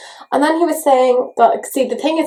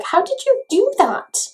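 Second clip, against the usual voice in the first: baffled question; 10 semitones higher, slower than usual. Retold or imitated. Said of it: "kind of being like".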